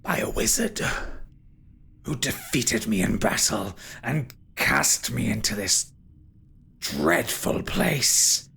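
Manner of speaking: whispery, brooding spirit voice; ghostly villain. Acted. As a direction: tell the grim history in a menacing half-whisper. Game master voices Derethor.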